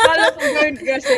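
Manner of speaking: high, loud, through laughter; loud laughing protest; laughing excuse. Real, not acted. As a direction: explain yourself while cracking up. Laughing over a false alarm.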